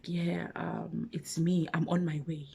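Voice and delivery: soft, partly whispered caller; lightly imitated hushed phone voice. He calls to say he's coming.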